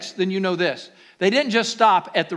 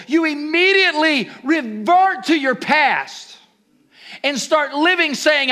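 higher, louder preacher's push; fiery preaching; 7 semitones higher, 4 dB louder than usual. Acted. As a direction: preach it out to the congregation. Preaching at full force.

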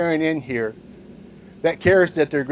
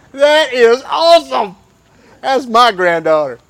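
much higher, louder proud boasting; proud grandparent; 6 semitones higher, 5 dB louder than usual. Retold or imitated. Grandpa bragging over his screaming granddaughter, loud and proud. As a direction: crow it like a beaming grandpa.

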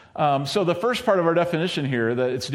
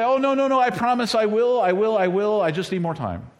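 put-on pleading kid; 7 semitones higher, 3 dB louder than usual. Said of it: "And you say".

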